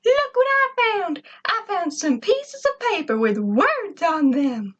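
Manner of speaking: bursting to show his find; excited robot. A narrator's reading. Robot Gur shows his master the paper, excited. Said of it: "he cried excitedly".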